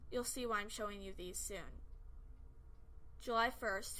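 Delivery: ordinary delivery, nothing marked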